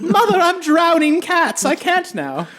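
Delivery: high, slow brat brushing off mom; kid answering mother. Pretend. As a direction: play a creepy kid too busy to come.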